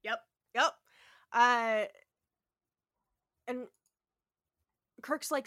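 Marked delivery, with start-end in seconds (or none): none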